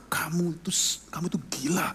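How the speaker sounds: hushed scolding. Retold or imitated. Father whispering to his hot-headed son, a whispered scolding. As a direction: hiss it so the soldier can't hear.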